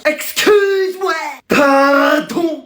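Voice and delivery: high, drawn-out mock outrage; hammed-up parental indignation; scandalized disbelief. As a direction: stretch it out in theatrical shock. Parents outraged at child's PS5 request.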